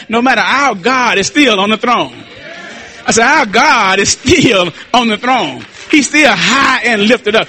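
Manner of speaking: sermon cadence building to a stretched-out climax; fervent preaching. Real, not acted. Preacher declaring God still on the throne, fervent preaching. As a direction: proclaim it triumphantly, drawing out the titles.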